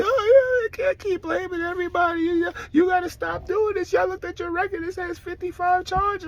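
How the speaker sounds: high mimicking voice, lecturing; mocking the girls. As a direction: parody a nagging baby mama. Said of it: "them girls like".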